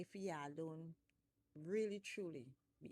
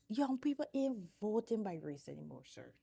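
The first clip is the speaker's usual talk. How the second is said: heated rant; 3 semitones higher, 8 dB louder than usual. Real, not acted. Ranting at political party.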